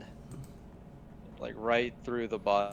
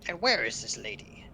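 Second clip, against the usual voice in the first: goblin voice; 3 semitones higher than usual. Acted. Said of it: "Goblin voices".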